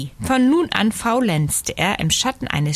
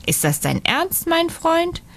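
astonished question, climbing high at the end; 10 semitones higher than usual. A narrator's reading. Friend answers a cheeky demand for payment, astonished. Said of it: "erstaunt zur Antwort".

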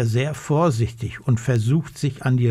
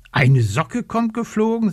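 higher, amazed question; surprised bear; 9 semitones higher than usual. A narrator's reading. Eddy the bear, astonished, repeats the mouse's words. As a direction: wonder aloud as the bear. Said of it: "sagt Eddy der Bär".

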